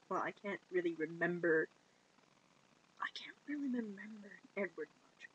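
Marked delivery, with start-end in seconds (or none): 3.0-5.3 breathy whisper. Retold or imitated. Bella whispering to Jacob that she can't remember. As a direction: drop to a whisper on the repeat. Same line repeated as whisper.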